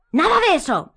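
loud high refusal; Harry bellowing. A narrator's reading. Harry bellowing at Hermione. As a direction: bellow it back. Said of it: "bramó él".

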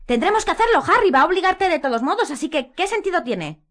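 high, loud plea; urging Harry; Hermione pleading. A narrator's reading. Hermione urging Harry, high and loud.

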